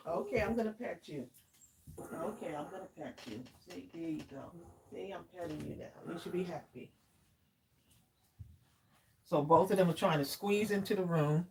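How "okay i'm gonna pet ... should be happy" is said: much softer, gentle coaxing; calm the dog down as you pet it; soothing the dog. Real, not acted. Owner soothes her barking dog while petting it, soft and gentle.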